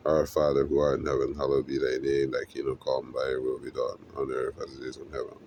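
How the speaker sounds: a fast, routine recitation of the prayer, trailing off; recited prayer. Acted.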